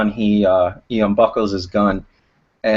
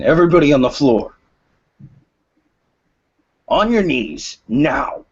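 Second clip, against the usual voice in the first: higher-pitched shouted order, loud at first; gruff yelled command; 5 semitones higher, 3 dB louder than usual. Acted. Robber ordering everyone inside the store down, gruff yell. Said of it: "You can hear a gruff voice saying".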